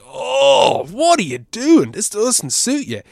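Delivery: high, slow, exasperated plea; mock cringing at someone, drawn out; exasperated disbelief. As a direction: open with a loud anguished "oh". Acting out his exasperated reaction.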